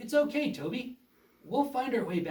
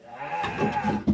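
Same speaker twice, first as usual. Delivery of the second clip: evil cackle; 7 semitones lower, 4 dB louder than usual. Acted. Villain enters with cackle.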